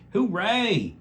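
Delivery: high, loud hooray; the garden friends cheering the wedding news; cheering bugs. A narrator's reading. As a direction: squeal it with joy. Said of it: "said Phil and Dennis the bugs".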